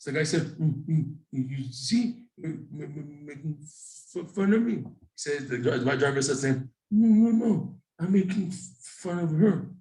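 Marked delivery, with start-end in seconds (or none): 0.6-4.8 blocked, halting stammer that bursts out; imitated stutter. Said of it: "we talk like, like this". 6.9-9.7 high stammered denial; imitated stutter. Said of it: "we talk like, like this".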